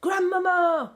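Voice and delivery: high, loud desperate call; boy shouting for grandmother. A narrator's reading. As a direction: yell it through the door. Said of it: "I shouted as loudly".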